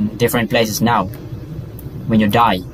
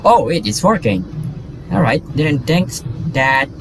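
ordinary talk

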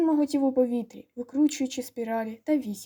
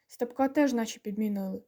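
usual speaking voice

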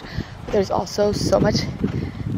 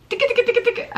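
playful tickle voice; 13 semitones higher, much faster than usual. Real, not acted. Tickling toddler.

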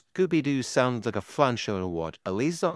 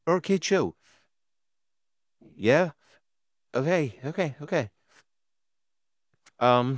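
ordinary talk, nothing marked

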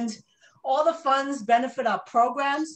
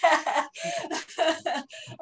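breathless laugh; 5 semitones higher than usual. Real, not acted. Breathless laugh after dancing.

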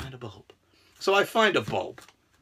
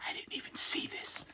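hushed secret whisper; 19 dB quieter than usual. Real, not acted. Hiding the package from his wife, whisper.

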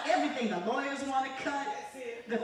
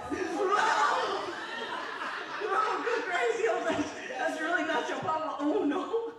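high, excited whooping reaction; laughing mock shock; 6 semitones higher, slower than usual. Preacher jokes to congregation about paternity, laughing mock shock. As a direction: exclaim in comic disbelief, laughing.

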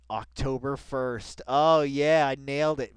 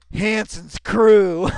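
drawn-out, louder and higher, savouring it; mock dramatic; 6 semitones higher, 14 dB louder, much slower than usual. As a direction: relish the words like a big reveal.

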